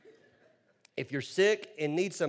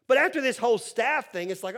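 ordinary delivery

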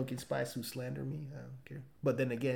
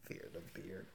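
breathy soft whisper; 16 dB quieter than usual. Pretend. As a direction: hush it like a secret. Slogan whispered after.

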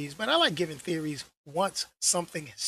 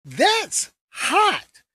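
high, loud and thrilled; excited ooh; 6 semitones higher, 6 dB louder, slower than usual. Retold or imitated. Vlogger gushing over a new trailer, excited. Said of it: "I was like".

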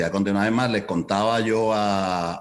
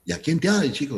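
puzzled Cuban manager, voice pitched up; Cuban accent; 8 semitones higher, faster than usual. Retold or imitated. A manager questioning the young promoter, Cuban accent. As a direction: ask it like the older Cuban boss. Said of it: "con el acento cubano".

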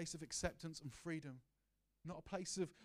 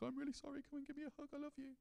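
much higher, soft and tender; tender apology; 10 semitones higher, 6 dB quieter, faster than usual. Retold or imitated. Father making up with his son, high and tender. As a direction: melt into a gentle sorry. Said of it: "I was like".

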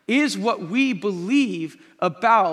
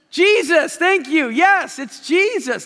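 excited affirmation; 7 semitones higher, 5 dB louder, slower than usual. Real, not acted. Congregation answers; pastor responds.

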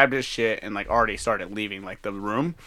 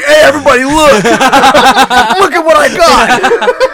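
very high, loud, laughing holler; mock shout to everyone; 13 semitones higher, 15 dB louder, slower than usual. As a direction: call the whole room over, hammed up and drawn out. Acts out showing off to everyone.

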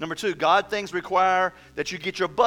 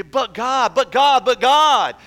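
higher, louder, each "but God" pushed harder; escalating pleading cry; 6 semitones higher, 10 dB louder, slower than usual. Acted. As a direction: beg God desperately, building to a shout. Acted excuses to God escalate.

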